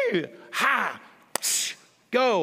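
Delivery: odd cooing and shushing at a raccoon; shooing a raccoon out of the garage with made-up noises; mock raccoon noises. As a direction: try to talk raccoon with made-up sounds. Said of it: "start making whatever sounds I think would communicate to a raccoon".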